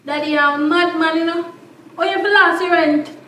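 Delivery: loud, heated telling-off; shouting at her father about the money; angry scolding. Acted. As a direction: rage at him for wasting the rent. Daughter scolds father over rent money.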